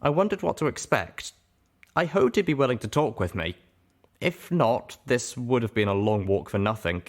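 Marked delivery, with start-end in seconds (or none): none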